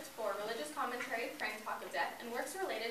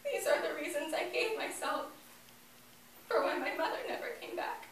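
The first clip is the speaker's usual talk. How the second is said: grief-stricken; 8 semitones higher than usual. Acted. Grieving child lists self-blame.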